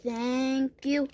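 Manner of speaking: ordinary talk, nothing marked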